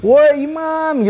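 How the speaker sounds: long high drawn-out shout of a friend's name; calling out. Retold or imitated. Lost on the trail, shouting slowly to find friends. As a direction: call across the dark mountain, stretching the name. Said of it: "Akhirnya gue teriak".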